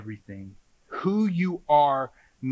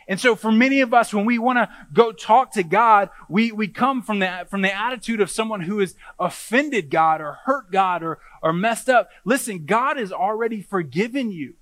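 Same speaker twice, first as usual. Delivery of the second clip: raised, higher-pitched preaching voice; emphatic preaching; 7 semitones higher, 8 dB louder than usual. Real, not acted. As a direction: drive each phrase home to the congregation.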